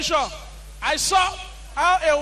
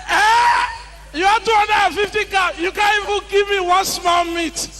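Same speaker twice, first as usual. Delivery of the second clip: high, loud disbelieving cry; shocked outrage; 5 semitones higher, 4 dB louder than usual. Retold or imitated. Groom shocked at the father's cows, high and loud.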